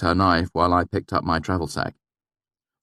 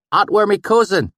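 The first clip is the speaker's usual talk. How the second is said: valley dialect; 8 dB louder than usual. A narrator's reading. Narrator faking the swineherd's dialect to gain trust, valley accent. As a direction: slip into the valley dialect to win him over. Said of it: "my sudden change in dialect".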